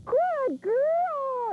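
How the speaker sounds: high, bright praise for the dog; excited praise. Shown as a demonstration. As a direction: gush over the dog like she's a star. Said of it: "Get excited".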